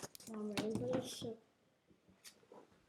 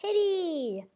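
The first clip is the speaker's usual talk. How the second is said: cutesy high voice; 8 semitones higher, 7 dB louder than usual. Real, not acted. Cutesy word.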